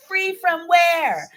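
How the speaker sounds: imitating a mother's rising, suspicious voice; mom's voice going high. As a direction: draw it out high like trouble is coming. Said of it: "when her voice gets a little high".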